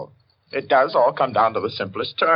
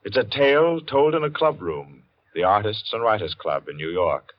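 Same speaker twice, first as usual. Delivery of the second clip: measured, dramatic announcer read, slowing to a hush; radio announcer intro; 4 semitones lower than usual. Acted. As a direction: build suspense like an old radio host.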